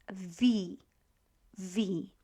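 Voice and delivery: "V" said as "vee"; pronunciation demo. Shown as a demonstration. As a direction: Polish W and I blended into "V". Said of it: "W and I together is V".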